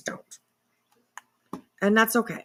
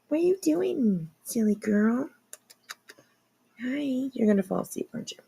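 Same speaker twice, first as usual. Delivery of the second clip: high, soft cooing voice; 4 semitones higher, 6 dB quieter than usual. Real, not acted. Streamer coos at her cat behind the computer, soft high baby talk. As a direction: sweet-talk a sleepy pet.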